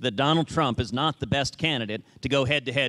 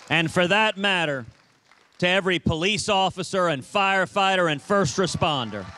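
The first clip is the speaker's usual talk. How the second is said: higher, rising rally shout; rally speech; 4 semitones higher than usual. Real, not acted. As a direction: build to a cheering climax. Rally climax honouring troops.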